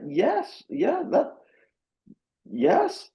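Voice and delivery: a high, excited string of yeses. Real, not acted. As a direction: jump up in pitch, eagerly agreeing. Eager agreement, pitch leaps.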